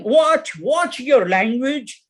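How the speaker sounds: high, drawn-out reprimand; headmaster's scream. Acted. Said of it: "screams our English headmaster".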